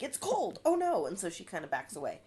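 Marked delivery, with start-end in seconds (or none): 0.0-1.1 high startled yelp; imitating a doll's cold-shower shock; mock shock. Barbie under the cold shower, a high startled cry.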